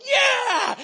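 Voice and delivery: loud, high whoop through a laugh; excited yell. Retold or imitated. Caught up in the healing rush, a loud laughing whoop. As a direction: let out a joyful whoop. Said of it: "I was like".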